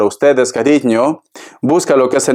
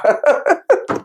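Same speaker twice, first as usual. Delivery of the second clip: high laugh; 12 semitones higher than usual. Real, not acted.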